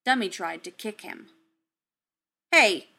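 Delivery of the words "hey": sharp loud hey; snapping at a misbehaving kid; snapped. Acted. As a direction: bark it to stop him. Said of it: "Tony snapped".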